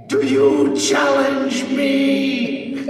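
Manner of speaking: high, loud, slow sung challenge; booming yell. Acted. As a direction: bellow the challenge slowly. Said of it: "I yell at them a question".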